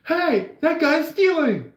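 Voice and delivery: high, drawn-out mock alarm call. Bystander alerts everybody that guy's stealing, high calling out. Acted bystander yelling in imagined scene.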